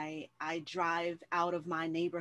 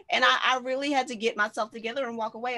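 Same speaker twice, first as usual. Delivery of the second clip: higher, louder, fast and giddy; excited retelling; 6 semitones higher, 8 dB louder, faster than usual. Real, not acted. Host telling the guest about her meltdown, high, loud, rushed. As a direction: rush through it, still laughing at yourself.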